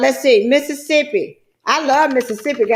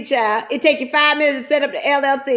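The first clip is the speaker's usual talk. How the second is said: normal talking voice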